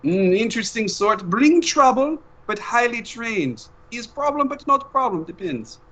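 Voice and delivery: a doting father checking on his son; Papa on the phone. Acted. Papa calls his son mid-mission, high accented voice.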